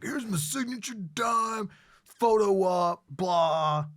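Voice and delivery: high, loud, slow announcer-style mockery; hammed-up big-shot boast; mock showboating. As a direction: imitate a loud publicity-seeker, dragging it out. Mock showman posing for photo op.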